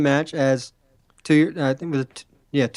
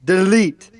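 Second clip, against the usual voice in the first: sharp, punched "delete"; in-character wrestling catchphrase; Broken Matt Hardy delete; 4 semitones higher, 6 dB louder than usual. Acted. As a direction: spit out "delete" like a deranged wrestler. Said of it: "I'm already in the character".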